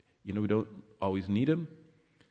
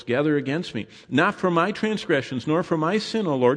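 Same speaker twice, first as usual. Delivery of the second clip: higher pleading psalm read; scripture reading; 5 semitones higher, 3 dB louder than usual. Acted. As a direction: cry it out like David. Preacher reads psalm aloud.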